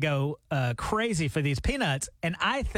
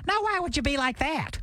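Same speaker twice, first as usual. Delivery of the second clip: higher, kindly imitation of a friendly star; 8 semitones higher than usual. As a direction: play him sweet and puzzled. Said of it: "And he goes".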